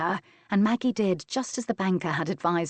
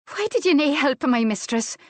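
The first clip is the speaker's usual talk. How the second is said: higher, louder Scots maid's challenge; demanding; 8 semitones higher, 5 dB louder than usual. A narrator's reading. Maid confronts the man who pulled her inside, demanding. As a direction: demand an answer, pitched up and loud. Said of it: "Maggie demanded".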